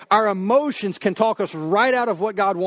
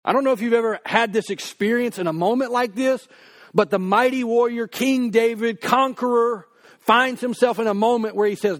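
ordinary delivery

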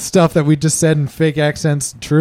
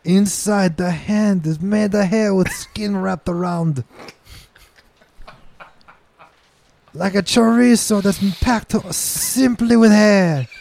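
slow, relishing mock Portuguese description; fake Portuguese accent. Describing hairy chorizo in a fake Portuguese accent. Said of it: "racially ambiguous fucking accent".

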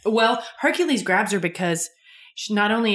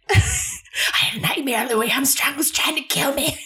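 imitating the mom; 8 semitones higher than usual. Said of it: "the mom from Throw Mama from the Train".

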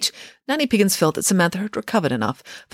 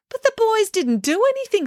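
dismayed. A narrator's reading. Headmaster objects in dismay.

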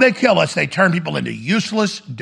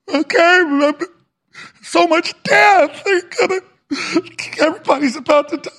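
put-on much higher, trembling, tearful old man; weepy Shatner; 11 semitones higher than usual. Host mocks William Shatner crying after the spaceflight, high and tearful. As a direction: wail it like a weepy old actor back from space. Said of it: "and goes".

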